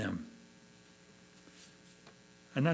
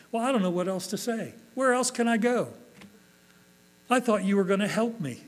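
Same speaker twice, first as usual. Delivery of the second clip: higher-pitched voiced bewilderment; distressed complaint; 7 semitones higher than usual. Disappointed believer asks God where else to go, mildly distressed. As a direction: act out helpless protest, pitched up.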